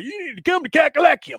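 high, loud pitch to come south; excited urging. Retold or imitated. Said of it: "everybody that I know is like".

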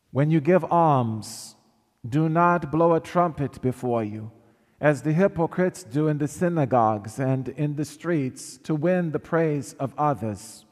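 ordinary talk

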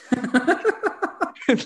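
high, loud burst of real laughter. Real, not acted. Big laugh at the crack den.

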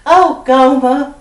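a put-on Southern belle sigh from an old sitcom; fake Southern accent. Turning on a fake Southern accent for a TV producer on the phone. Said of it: "that big fake accent".

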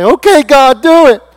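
loud, high-pitched giving in; exasperated surrender shout. Acted. Believer in pain gives in to God, exasperated shout.

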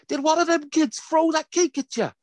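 high-pitched Irish mum demanding answers; Irish neighbour. Retold or imitated. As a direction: interrogate over the fence, high Irish voice. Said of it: "they've got beautiful little Irish accents".

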